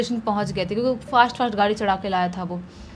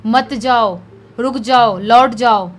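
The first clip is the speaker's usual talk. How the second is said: loud, drawn-out calls with pauses between; warning call; 8 dB louder than usual. Acted. Ghostly voice calls from behind.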